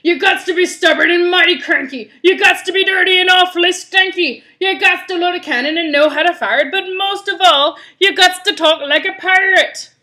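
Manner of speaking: rally the crew as a rough pirate. Acted. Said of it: "doing this pirate voice".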